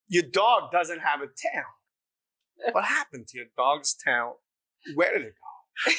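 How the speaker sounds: halting, deliberate actor impression; Christopher Walken. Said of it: "conversation with Christopher Walken".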